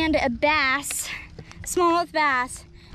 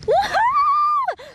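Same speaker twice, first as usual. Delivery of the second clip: very high, loud whoop; joyful shout; 5 dB louder than usual. Real, not acted. Angler whooping after releasing the fish. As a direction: whoop it to the sky.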